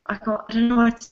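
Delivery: ordinary talk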